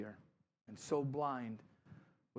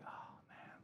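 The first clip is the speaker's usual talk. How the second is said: whispered sigh of regret; whispered lament; 17 dB quieter than usual. Retold or imitated. Counselor grieving a lost person to God, whispered. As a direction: let it out on a whisper.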